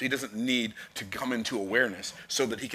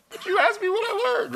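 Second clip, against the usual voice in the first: put-on squeaky, much higher little-kid voice; childlike protest; 6 dB louder than usual. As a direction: whine it like a small child. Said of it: "kid protests to mom".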